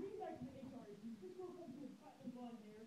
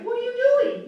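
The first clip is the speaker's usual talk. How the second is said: mock angry boss; 11 semitones higher, 29 dB louder than usual. Boss confronts him.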